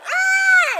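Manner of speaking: piercing high shout; character scream. Shown as a demonstration. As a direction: let out the character's battle yell.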